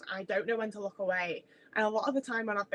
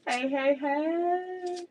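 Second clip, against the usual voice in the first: much higher, slow sing-song call; high slow hey; 8 semitones higher, much slower than usual. Acted. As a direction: lift it up high and stretch it. Greeting the cookie, pitched up.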